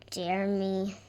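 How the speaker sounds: ordinary delivery, nothing marked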